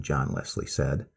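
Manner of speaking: plain reading voice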